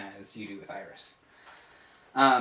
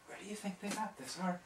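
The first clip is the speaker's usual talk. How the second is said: soft, higher, half-whispered; quiet apprentice; 6 semitones higher, 14 dB quieter than usual. Acted. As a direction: ask in a hushed, curious murmur. GM voices Jolien.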